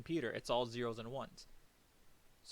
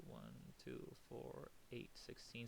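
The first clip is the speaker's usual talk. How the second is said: much softer, counting under the breath; counting to oneself; 13 dB quieter, slower than usual. Real, not acted. Teacher checking a binary sum for himself, counting under the breath. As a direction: work it out quietly to yourself.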